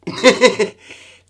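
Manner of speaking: high burst of laughter; loud laugh. Real, not acted. Laughs at own accent.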